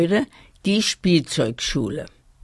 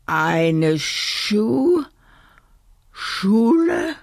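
flabbergasted stammer; 6 semitones higher, much slower than usual. A narrator's reading. Kasper stunned by the plan for a school, halting high question. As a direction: break off mid-word, then rise high. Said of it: "Kasper stotterte, so verblüfft war er".

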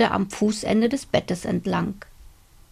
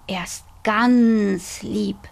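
slow, whispered comfort; reassuring whisper; slower than usual. Acted. Grandpa calms the frightened boy, slow and whispered. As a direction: hush him gently that the dragon is kind.

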